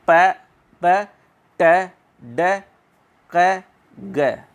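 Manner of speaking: प, ब, ट, ड, क, ग each released with a sudden puff; plosive demonstration. Shown as a demonstration. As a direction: say प, ब, ट, ड, क, ग one by one, air bursting out. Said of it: "हवा जो है झटके से बाहर आती है". (प, ब, ट, ड, क, ग झटके से, अलग-अलग)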